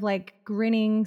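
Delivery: usual speaking voice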